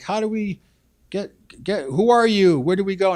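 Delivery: hammed-up lost newcomer asking the way; imitating lost settlers. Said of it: "settlers came and they were lost".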